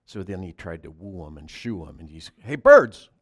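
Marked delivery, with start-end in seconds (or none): 2.4-3.1 loud hey to the birds; man shouting to birds outside the barn; calling out. Acted. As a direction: call the birds over.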